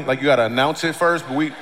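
ordinary delivery

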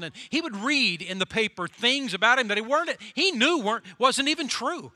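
loud, rising preacher's climb; preaching at full force. Acted. Preacher to the church, loud and rising. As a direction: build the outrage for the congregation.

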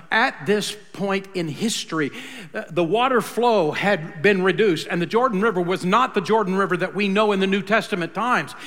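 usual speaking voice

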